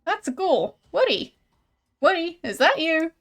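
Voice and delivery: surprised, with swooping pitch; incredulous discovery. Acted. Player recognising Woody inside the fake sarcophagus, incredulous. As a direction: question it in growing disbelief.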